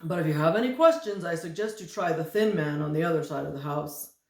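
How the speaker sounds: quick, loud, chirpy figure; fast cheery fat man. Acted. Fat man sends them to the thin man, quick and chirpy. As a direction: rattle off the directions cheerfully.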